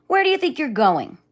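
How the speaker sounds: loud, high menacing demand; threatening lion. Acted. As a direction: bark it at the trespasser.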